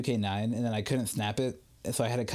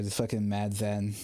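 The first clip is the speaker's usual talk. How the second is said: usual speaking voice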